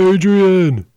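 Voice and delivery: high, loud drawn-out cry of a name; Sylvester Stallone. Acted. Player acting the boxer calling out after the match, hammed-up yell. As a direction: bellow it like the beaten movie boxer. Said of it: "Sylvester Stallone is who?".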